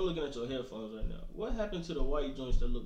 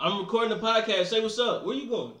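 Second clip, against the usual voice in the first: raised to get through a bad line; louder repeat; 5 semitones higher, 5 dB louder than usual. Real, not acted. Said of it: "Say it again".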